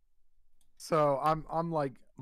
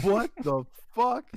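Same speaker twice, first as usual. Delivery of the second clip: high, louder incredulous outburst; shocked disbelief; 8 semitones higher, 5 dB louder than usual. Retold or imitated. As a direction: retell it as mock outrage. Retold shock at overheard remark.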